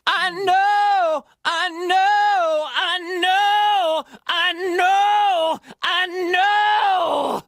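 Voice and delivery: high, loud sung with grit; gritty belting. Shown as a demonstration. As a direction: belt it with growing grit. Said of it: "I can crescendo this grit".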